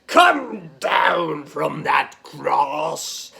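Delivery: slow, drawn-out taunting with laughter breaking in; a gloating priest's jeer, dragging the words out; mocking Caiaphas. Caiaphas taunting Jesus on the cross, slow mockery. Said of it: "Caiaphas laughs".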